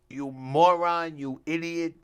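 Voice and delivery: loud, slow furious bellow down the phone; mock boss screaming at his agent over the phone; imitated boss yelling. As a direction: bark it like an enraged boss on the line. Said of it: "you could hear the agent ... telling him".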